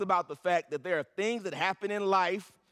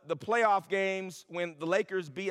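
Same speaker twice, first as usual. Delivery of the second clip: ordinary talk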